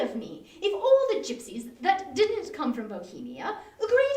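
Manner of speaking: a husband chuckling at silly superstition; laughing it off. Acted. Joshua laughing off warning.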